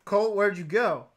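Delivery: loud, high, drawn-out call. Real, not acted. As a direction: shout after someone who wandered off. Calling after a returning friend.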